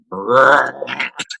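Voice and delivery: rolled, rumbling noise of a ladle hitting a bullfrog; imitated bullfrog noise. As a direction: make a comic trilled frog sound. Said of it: "You hear".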